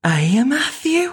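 high Scouse question, dragged out; Scouse accent. Pretend. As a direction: ham up a Liverpool accent on the name. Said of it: "try to do Scouse with me".

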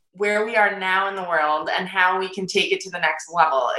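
ordinary talk, nothing marked

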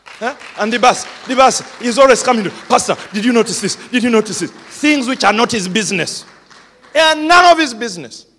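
animated complaint; exasperated. Real, not acted. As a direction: push it with mock annoyance.

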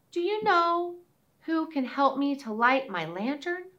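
put-on high, pleading child voice; a small girl asking for help; little girl voice. Girl asks hedgehog.

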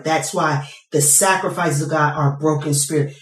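ordinary talk

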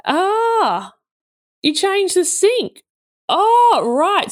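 high, drawn-out oh of hidden disappointment; polite letdown. Retold or imitated. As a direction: feign interest while taking in a letdown. Let down by the surprise.

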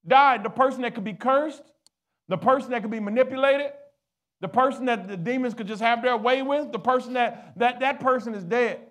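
high, loud sermon climax; preaching at full force. Real, not acted. Preacher assures believers demons cannot curse them, full-force preaching. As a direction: preach it out with fire.

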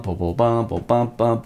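ordinary talk

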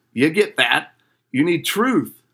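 ordinary delivery

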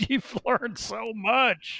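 high, slow and laughing; laughing encouragement. Real, not acted. Coach cheering on a client, high laughing voice. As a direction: stretch it out through a laugh.

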